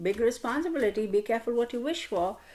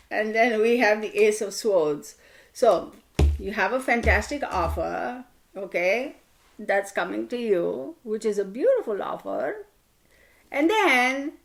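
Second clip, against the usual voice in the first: ordinary talk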